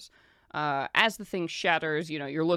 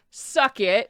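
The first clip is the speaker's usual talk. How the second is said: gloating taunt; 5 semitones higher, 10 dB louder than usual. Acted. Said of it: "and she's like".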